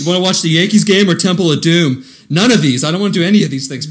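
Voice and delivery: a fed-up friend refusing both the game and the movie, whining loud and high; mock whiny complaint. Impersonating Preble's complaint.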